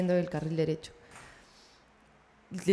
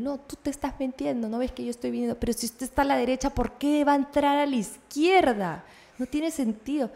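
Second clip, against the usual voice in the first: slightly higher, mocking defensive driver; lightly voiced indignant driver; imitating the driver; 5 semitones higher than usual. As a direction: mimic his excuse, a bit whiny. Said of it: "se espanta cuando me ve".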